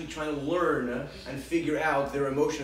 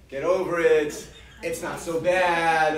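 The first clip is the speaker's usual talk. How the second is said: louder brushing-off parent tone; dismissive parent; 2 semitones higher, 8 dB louder, slower than usual. Shown as a demonstration. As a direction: wave the kid's feelings away. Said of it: "What would be the wrong way".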